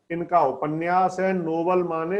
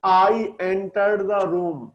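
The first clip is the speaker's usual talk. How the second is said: slow dictation; much slower than usual. Shown as a demonstration. Teacher reading example sentence slowly.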